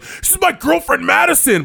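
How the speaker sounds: loud, high, hammed-up introduction; mock drunk guy. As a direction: blurt it like a drunk fan. Imitates blackout drunk guy introducing girlfriend.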